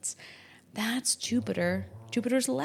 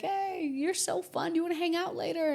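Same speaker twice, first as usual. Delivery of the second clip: mock friendly; 9 semitones higher than usual. Friendly invite at concert.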